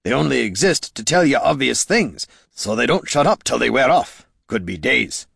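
a hint of character, pitch dropping at the end; lightly voiced grumbling Gorm. A narrator's reading. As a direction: grumble the explanation to a companion. Voices Gorm grumbling about sprites.

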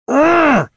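loud, high exasperated urgh; frustrated groan. Acted. Loruna vents frustration at Catha, loud groan. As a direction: let the frustration burst out.

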